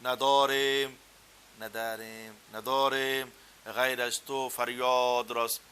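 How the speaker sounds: Persian accent, words stretched long and rounded, slow and loud; Persian-style recitation. Shown as a demonstration. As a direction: draw out and round every word like a native Persian. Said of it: "وہ لفظ لمبا کرنا، گول کرنا".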